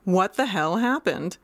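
normal talking voice

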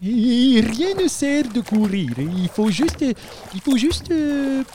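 high, slow, sing-song swimmer; high silly voice. Acted. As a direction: play the carefree swimmer in a high voice.

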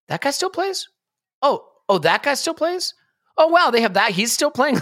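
high-pitched mock disbelief; incredulous. Pundit imagining someone reading Croatia's squad list, high-pitched incredulous. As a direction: voice leaps up in comic astonishment.

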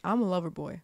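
usual speaking voice

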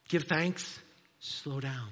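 ordinary talk

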